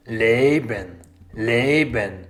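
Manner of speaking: ordinary talk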